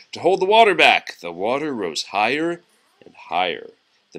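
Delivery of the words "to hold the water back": mild shout. Acted. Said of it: "shouted Scuffy".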